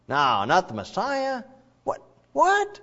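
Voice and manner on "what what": mock surprise. Mock shock at suffering servant.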